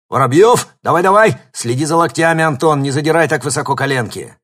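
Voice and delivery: loud coach yelling, high; barking gym coach. A narrator's reading. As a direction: shout drills at the kids.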